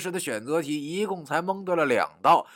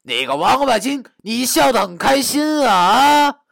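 loud, high, menacing taunt; fierce, vicious; 11 semitones higher, 9 dB louder than usual. Acted. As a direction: spit it out fiercely, building to a yell. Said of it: "并狠狠地说道".

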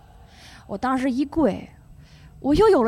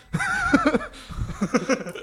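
real laugh; 5 semitones higher than usual. Real, not acted. Laughing after the joke.

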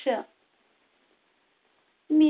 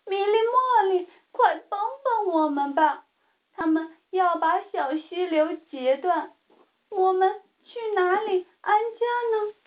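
higher pleading voice, mildly sad; sad plea; 6 semitones higher than usual. A narrator's reading. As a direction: beg for help, a touch of sorrow. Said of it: "水鸟们伤心地说道". (略带伤心的恳求)

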